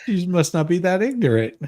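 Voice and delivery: usual speaking voice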